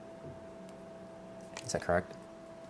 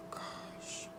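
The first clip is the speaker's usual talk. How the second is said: whispered gosh; 18 dB quieter than usual. Real, not acted. Psychic moved during reading.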